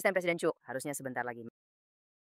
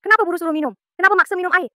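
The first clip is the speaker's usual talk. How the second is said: higher, louder, sharp accusing questions; suspicious outburst; 7 semitones higher, 5 dB louder, faster than usual. Acted. Wife to husband pushing tea, higher louder suspicious challenge. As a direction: demand why he pushes the tea.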